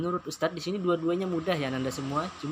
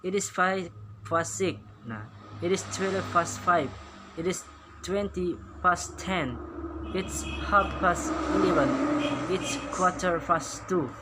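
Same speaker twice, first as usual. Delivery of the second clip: reading aloud together; 3 semitones higher than usual. Shown as a demonstration. Teacher leads pupils reading British times aloud. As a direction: lead the class through each time. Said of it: "kita baca sama-sama".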